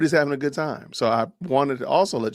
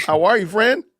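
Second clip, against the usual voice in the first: excited greeting; 10 semitones higher, 8 dB louder than usual. Real, not acted. Host greets a guest.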